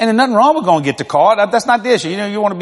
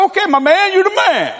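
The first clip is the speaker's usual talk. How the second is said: much higher, teasing dad voice; mock dad humoring; 12 semitones higher than usual. Dad humors his teenage son, teasing high voice. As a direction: play dad patronizing the boy.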